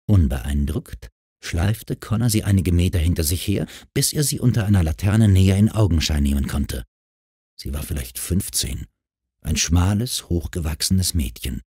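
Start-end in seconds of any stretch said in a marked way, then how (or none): none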